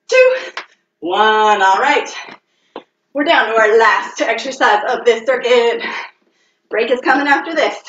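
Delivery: ordinary talk, nothing marked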